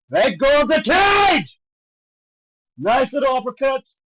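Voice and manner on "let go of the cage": slow, high yell stretching the last word; drawn-out indignant shout. Real, not acted. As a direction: bellow the order slowly at the fighter. Repeated yell at the fighter.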